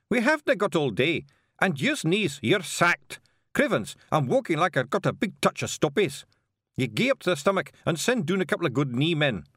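high, scolding broad Scots; bossy, exasperated little Scotsman; impatient Scottish stranger. Acted. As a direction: dress down slack underlings in high-pitched Scots. Stranger scolds his knees.

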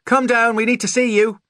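very high, loud calling voice; mother calling out. A narrator's reading. Mother summoning her son downstairs, high loud call. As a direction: holler up to someone out of sight. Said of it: "she called".

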